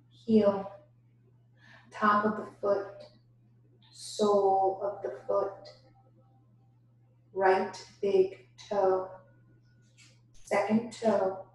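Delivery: ordinary delivery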